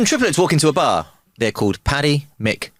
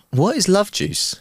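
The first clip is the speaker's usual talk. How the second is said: put-on slow, high child's question; a hint of innocent little Billy; little boy asking; 7 semitones higher, slower than usual. Joke teller voices little Billy.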